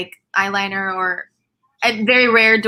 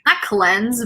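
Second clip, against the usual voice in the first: ordinary talk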